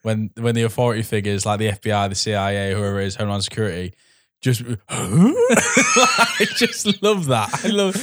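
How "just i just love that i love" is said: higher, louder, slow laughing gush; laughing delight. Real, not acted. Loving the bumbling federal agents, laughing gleefully. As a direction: laugh through it with glee. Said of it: "delighted about bumbling agents".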